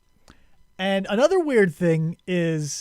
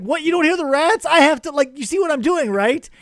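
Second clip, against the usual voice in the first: high, louder disbelieving plea; incredulous mock plea; 8 semitones higher, 6 dB louder than usual. Master pleads with servant about the rats, high and incredulous. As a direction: beg them to notice the rats. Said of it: "He's like".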